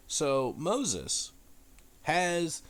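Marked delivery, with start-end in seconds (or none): none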